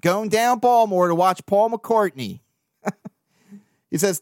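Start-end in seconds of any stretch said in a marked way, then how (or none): 0.0-2.4 lightly put-on Baltimore accent, a bit louder; playful Baltimore accent reading a fan's email. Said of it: "in a Baltimore accent".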